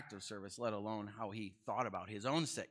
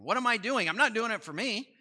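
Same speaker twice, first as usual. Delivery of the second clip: impassioned Paul; 10 semitones higher, 8 dB louder than usual. Retold or imitated. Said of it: "He says".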